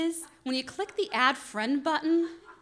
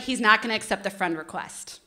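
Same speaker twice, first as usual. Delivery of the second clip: ordinary delivery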